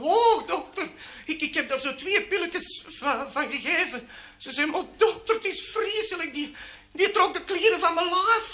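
high-pitched, hammed-up distress; flustered patient. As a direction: tell the doctor in mock horror, voice up high. Flustered woman reporting to doctor. (hoog en gespeeld ontdaan)

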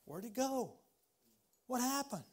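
puzzled wondering. Retold or imitated. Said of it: "I say".

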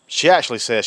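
ordinary talk

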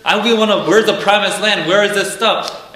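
complaining Israelites. Retold or imitated. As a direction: accuse Moses of dragging them to the desert. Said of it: "they're telling Moses".

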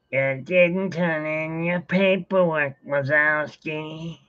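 slow, rasping deadpan drawl; bored clerk croaking slowly; slow raspy Roz impression. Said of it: "That's probably the best voice to do".

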